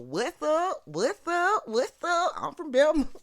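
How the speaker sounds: high, loud mock holler; hammed-up high-pitched call-out; rowdy hometown shout-out. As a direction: holler it in playful hometown pride.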